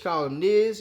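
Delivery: normal talking voice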